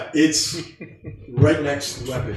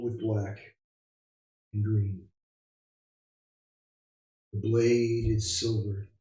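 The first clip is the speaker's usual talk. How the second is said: game master narration; 6 dB quieter than usual. Acted. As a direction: paint the scene softly. GM scene narration.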